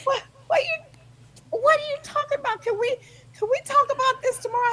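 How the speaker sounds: high-pitched, baffled protest; woken husband imitation. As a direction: lightly mimic a husband pleading to sleep. Imitates sleepy husband replying.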